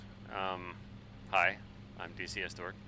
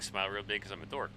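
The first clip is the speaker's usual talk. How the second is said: ordinary talk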